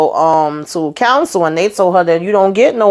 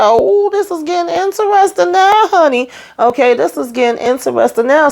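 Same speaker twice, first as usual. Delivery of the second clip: high, excited squeal of delight; delighted gossip; 10 semitones higher than usual. Retold or imitated. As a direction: relish the juicy twist, voice way up. Own delighted reaction, retold.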